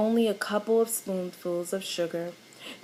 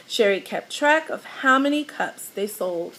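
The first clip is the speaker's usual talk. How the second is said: plain reading voice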